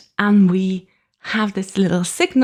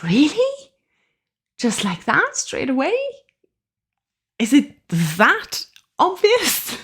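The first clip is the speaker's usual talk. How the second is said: high, incredulous questions with rising pitch; mock-astonished inner voice; retold disbelief; 7 semitones higher than usual. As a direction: act out being taken aback, pitched well up. Retelling her taken-aback thought.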